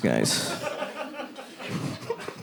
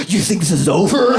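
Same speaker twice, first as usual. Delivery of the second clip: high, louder menacing taunt; mock threat to a woman he passed; movie villain taunt; 7 semitones higher, 4 dB louder than usual. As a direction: tease her like a thriller villain. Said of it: "I go".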